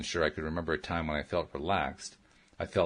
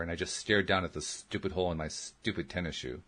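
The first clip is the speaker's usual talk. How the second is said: usual speaking voice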